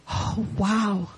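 softly, to oneself in wonder; hushed awe. Retold or imitated. Guest thinking at the villagers' welcome, hushed awe. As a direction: let a quiet wow slip out. Awed thought, retold.

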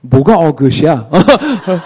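loud, sharp mock comeback in Korean; wife mocks his optimism in Korean, pointed; wife's retort in Korean. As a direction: snap back sceptically as the wife. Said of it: "Sorry, let me translate".

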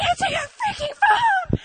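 very high, loud exasperated shout; frustrated yell. Retold or imitated. Friend won't pick up, a high exasperated yell. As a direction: yell at the unanswered phone.

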